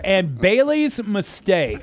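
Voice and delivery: big, drawn-out band introduction; announcer introduction. Real, not acted. Host introduces the band in studio, slow showy announcement. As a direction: stretch the name out, loud and high.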